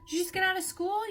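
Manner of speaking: put-on high, carrying call to a distant child. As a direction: shout across the yard to get her attention. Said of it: "she starts to yell at her".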